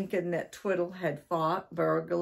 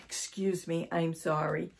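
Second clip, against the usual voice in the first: ordinary delivery, nothing marked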